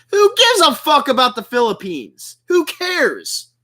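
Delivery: high-pitched, irritated outburst; pissed off. Real, not acted. Streamer scoffing at a rival's boasting, pissed off. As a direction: throw the question out in real exasperation. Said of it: "people like that just really piss me off".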